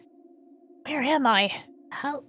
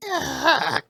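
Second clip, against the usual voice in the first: groan; 3 semitones higher, 8 dB louder than usual. Acted. Waking up after the fall.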